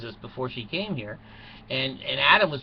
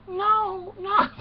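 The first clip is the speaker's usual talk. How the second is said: put-on high falsetto girly no; high loud falsetto protest; coy denial; 4 dB louder than usual. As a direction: squeal it like a coy girl. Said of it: "They go".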